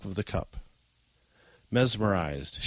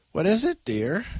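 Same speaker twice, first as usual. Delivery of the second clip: put-on old woman voice; 10 semitones higher, 3 dB louder than usual. A narrator's reading. Grandma voiced in story.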